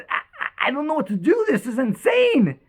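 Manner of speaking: high, stammering disbelief; mildly acted distraught. [name] tells his girlfriend what happened, overwhelmed, lightly acted. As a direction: stumble in, then lift the pitch in astonishment. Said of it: "he's crying and he's crying".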